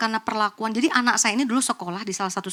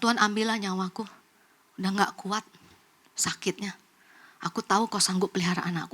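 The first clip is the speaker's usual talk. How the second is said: despairing prayer; 4 semitones lower, 6 dB quieter than usual. Retold or imitated. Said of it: "Kemudian dia bilang sama Tuhan".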